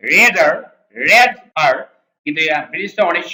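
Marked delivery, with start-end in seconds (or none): 0.1-1.7 rather in an American accent, the r fully sounded. Shown as a demonstration. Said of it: "American ভাষায় বলা হয়".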